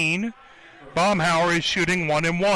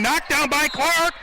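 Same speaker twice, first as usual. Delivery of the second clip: excited; 9 semitones higher than usual. Real, not acted. Ball knocked out, quick call.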